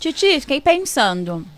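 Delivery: talking with a mouth full of peanuts. Real, not acted. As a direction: speak through a mouthful. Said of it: "falando de boca cheia".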